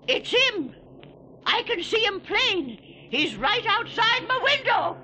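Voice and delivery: high, panicked cries of alarm; terrified. Acted. Keeper seeing the Whir at his window, terrified. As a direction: shriek it like the monster is at the window. Keeper sees the monster outside.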